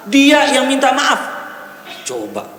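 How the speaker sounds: high, forceful sermon delivery; full-force preaching. Acted. Preacher astonished by the hadith on wives apologising, impassioned. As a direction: drive the point home loudly.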